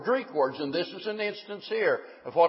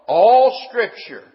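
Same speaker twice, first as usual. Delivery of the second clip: "All Scripture" louder and slow, with "All" punched; stress on ALL; 4 semitones higher, 8 dB louder, slower than usual. Shown as a demonstration. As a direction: drive home "All Scripture" slowly. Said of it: "But notice again".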